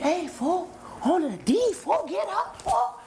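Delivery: high, strained imitation of a yelling inmate; mock yelling cellmate. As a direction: ham up the cellie hollering get up. Imitates the Mexican cellie yelling.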